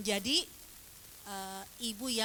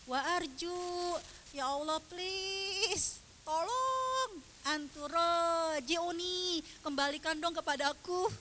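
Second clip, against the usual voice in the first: high, drawn-out plea to God; pleading prayer; 6 semitones higher, slower than usual. Acted. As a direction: beg with long stretched words.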